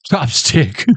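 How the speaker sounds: ordinary delivery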